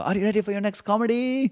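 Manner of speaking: put-on a squeaky overblown Indian accent, desperate emcee; bombing Indian host. Host bombs and pleads with the crowd, high mock Indian accent. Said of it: "the world's worst Indian host".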